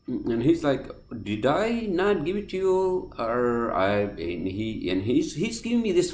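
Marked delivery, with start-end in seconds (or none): 1.2-3.1 mock accented cashier. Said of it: "he has some fucking thick accent".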